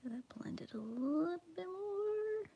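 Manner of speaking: soft ASMR whisper. Real, not acted. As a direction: whisper it close to the mic. Whispering while coloring.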